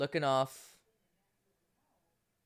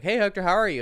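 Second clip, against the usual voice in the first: friendly greeting; 7 semitones higher, 7 dB louder, faster than usual. Retold or imitated. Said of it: "he came up and was like".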